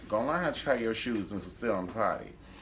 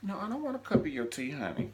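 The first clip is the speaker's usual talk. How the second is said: higher, sassy put-down; high mock sassy refusal; 7 semitones higher, faster than usual. Recapping a TV show, mocking a character's refusal. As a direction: toss off the refusal with a sweet sting. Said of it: "she was like".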